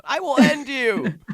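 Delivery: mock almighty Diorio. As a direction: ham up a self-important bully threatening someone. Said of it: "I could totally see him saying that".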